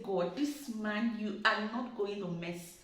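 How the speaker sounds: quietly steel yourself; muttered to herself. Retold or imitated. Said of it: "I looked at myself. I said".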